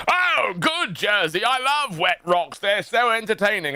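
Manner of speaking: high, gushing fake enthusiasm; mock delight. Host to co-host about a wet rock, mock delight. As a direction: gush as if utterly thrilled, pitched right up.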